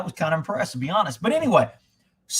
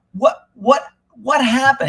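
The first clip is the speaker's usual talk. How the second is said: alarmed; 10 semitones higher, 8 dB louder, slower than usual. Retold or imitated. Girlfriend calls crying, alarmed.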